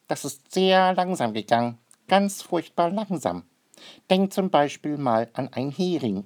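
high, light bird voice explaining eagerly; lively bird character. A narrator's reading. Maruffel lectures Noah about crawling fish, high bird voice. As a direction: stretch furchtbar langsam like a know-it-all bird.

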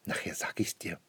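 breathy grandma whisper, no voice. Acted. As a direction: hush it to the child in church. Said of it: "flüstert Oma". (geflüstert, ganz ohne Stimme)

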